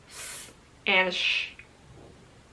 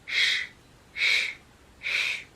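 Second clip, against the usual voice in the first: long sh like hushing; shushing sh; slower than usual. Shown as a demonstration. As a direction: shush quietly. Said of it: "telling you to quiet down".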